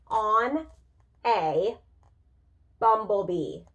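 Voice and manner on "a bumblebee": usual speaking voice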